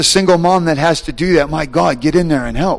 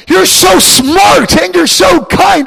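awed praise; 6 dB louder, slower than usual. Retold or imitated. Praising Jesus in the dream.

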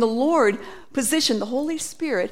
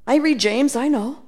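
normal talking voice

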